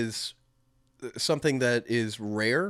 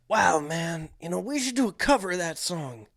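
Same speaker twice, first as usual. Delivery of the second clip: dazed rock star, higher pitched; 6 semitones higher than usual. Retold or imitated. Bandmate suggesting a cover, mock spaced-out rocker. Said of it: "[name]'s like".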